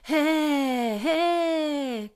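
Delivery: long notes leaking breath; breathy chest voice. Shown as a demonstration. Teacher showing what to avoid, breathy held notes. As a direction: let air escape through a held note. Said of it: "pour éviter qu'il y ait du souffle".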